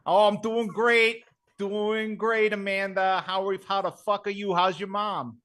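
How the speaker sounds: Brooklyn Vinny voice. Acted. Contestant introducing himself in a brash Brooklyn accent. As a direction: play a cocky Brooklyn guy on a dating show. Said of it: "Brooklyn Vinny".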